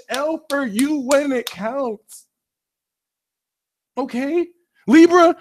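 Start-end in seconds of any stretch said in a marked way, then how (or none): 4.9-5.4 loud call of the name. Real, not acted. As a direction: shout the name across the room. Calling out Libra.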